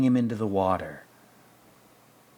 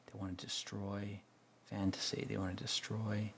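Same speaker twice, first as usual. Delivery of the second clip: ordinary talk, nothing marked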